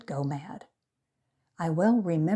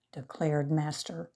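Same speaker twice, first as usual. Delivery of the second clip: usual speaking voice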